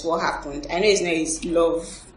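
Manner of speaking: ordinary talk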